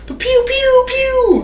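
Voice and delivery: high little pew pew gun sounds; mock gunshots. Sounding out the rafters archers firing, mock gunshots. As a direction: zap it out like a toy blaster. Imitating gunfire in a scene.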